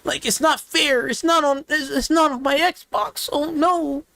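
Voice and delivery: high-pitched mock-distressed fanboy voice; hammed-up sarcastic lament; mock upset fanboy. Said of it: "I'm gonna cry myself to sleep".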